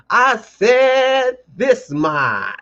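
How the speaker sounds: high, very slow preacher stretch; mock pulpit call. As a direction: draw it out like a preacher. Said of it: "let me put on my little preacher voice".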